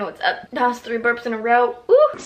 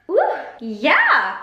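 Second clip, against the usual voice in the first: ordinary talk, nothing marked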